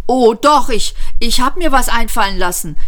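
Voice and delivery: high, loud, eager panting; gasped reply. A narrator's reading. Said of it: "keuchte Ford". (laut hervorgekeucht, in hoher Stimmlage)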